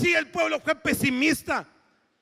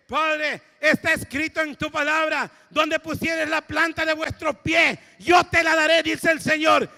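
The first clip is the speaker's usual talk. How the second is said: oración fuerte; 4 semitones higher, 6 dB louder than usual. Retold or imitated.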